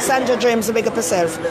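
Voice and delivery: normal talking voice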